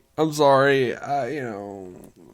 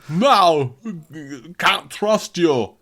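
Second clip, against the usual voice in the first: Euro Christopher Walken; 4 semitones higher, much slower than usual. Retold or imitated. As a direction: drag out "can't trust you" in a hammed-up Euro accent. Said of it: "a Euro Christopher Walken".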